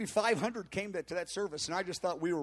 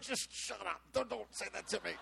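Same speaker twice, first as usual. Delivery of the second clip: muttered retort; 9 dB quieter than usual. Retold or imitated. Pastor's inner reply to flattery.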